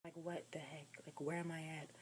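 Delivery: very quiet, bewildered aside; under the breath. Retold or imitated. Lost driver questioning herself, very quietly. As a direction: mumble it privately to yourself, barely audible.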